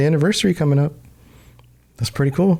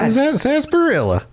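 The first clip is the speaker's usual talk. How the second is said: put-on old-timey prospector voice; 11 semitones higher than usual. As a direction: call it out like a gold-rush prospector. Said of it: "like, prospector voice".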